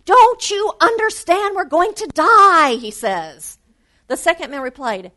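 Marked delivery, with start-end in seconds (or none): 0.1-2.8 high, loud, exasperated shout; frantic shout. A narrator's reading. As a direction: yell at the calm man in disbelief. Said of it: "it drove the other man crazy".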